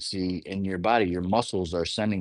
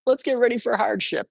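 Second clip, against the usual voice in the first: very high squeaky little cell voice. Retold or imitated. Body cells reacting to her complaint, in a very high squeaky voice. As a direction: chirp it like a tiny cartoon cell. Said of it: "the cells are like".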